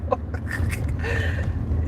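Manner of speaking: breathless airy laughter. Real, not acted. Streamer to chat after the live, airy laugh.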